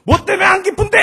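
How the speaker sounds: 아주 높고 크게 버럭; 폭발하듯 소리쳐 따져 묻기; 성질난 아빠. Retold or imitated. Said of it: "나도 성질나서". (버럭 내지르는 고함)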